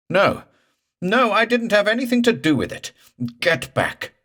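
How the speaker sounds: high, loud panicked denial; shrill with terror, pushing it away; terrified protest. Acted. Morse denies it to the ghost, high and panicked.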